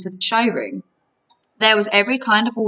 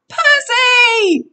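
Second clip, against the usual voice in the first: high, loud cry for help; yelling; 6 dB louder than usual. A narrator's reading. Grover calls his friend while being stretched, yelling. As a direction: yell the name, high and drawn out. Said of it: "Grover yelled".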